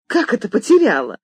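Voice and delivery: sharp, loud and high, beside herself; furious shout. A narrator's reading. Mistress scolding maid over lost dress, furious shout. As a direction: yell at the maid, fed up. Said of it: "вне себя закричала миссис Джек".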